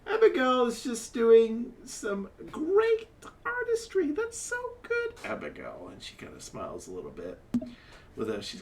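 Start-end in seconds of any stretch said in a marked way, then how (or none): 0.1-5.9 flamboyant half-elf. Acted. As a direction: coax him, full of flair. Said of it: "comes over and says".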